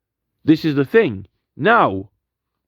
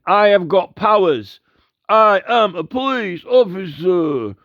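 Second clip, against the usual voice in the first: mock officious cop, pitched higher and climbing fast at the end; pompous police officer; 5 semitones higher than usual. Mocking the police officer who pulled him up, in a pompous officious voice. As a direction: puff yourself up like a self-important cop. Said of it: "He's going".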